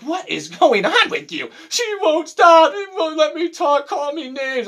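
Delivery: high, rushed mock panic; panicked coworker. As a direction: squeal like a frantic coworker. Retold caller on the phone.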